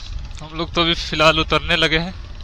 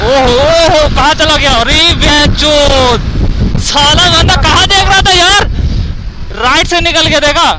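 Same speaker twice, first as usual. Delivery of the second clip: loud excited yelling; 7 semitones higher, 9 dB louder, slower than usual. Real, not acted. Yelling over wind during race.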